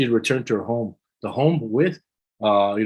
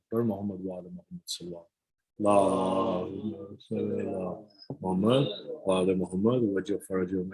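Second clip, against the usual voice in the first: quick, softer devotional recitation; recited salawat; 2 semitones lower, 5 dB quieter than usual. Acted. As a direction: run the blessing off in one breath.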